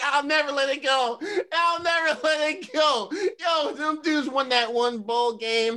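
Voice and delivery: laughing. Real, not acted. Mocking fast-food championship dinner.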